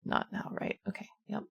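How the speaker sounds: very soft, under the breath; hushed self-talk. Acted. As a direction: murmur it privately to yourself. Character murmurs to herself at amulet.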